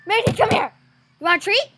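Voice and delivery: calling the dog. Real, not acted.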